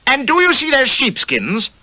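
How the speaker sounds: high, loud furious demand; bawling. A narrator's reading. Cardigan bawling at Reynolds over the sheepskins. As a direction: roar it at him, shaking with rage. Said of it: "bawled Cardigan".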